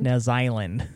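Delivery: "New Zealand" with Zea said like "zye"; attempted New Zealand accent. Trying the name in local accent.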